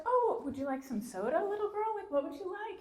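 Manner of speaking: put-on high, sweet voice offering soda to the little girl; sweet stewardess. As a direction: coo it up high like a doting flight attendant. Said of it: "she's like".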